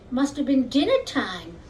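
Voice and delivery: usual speaking voice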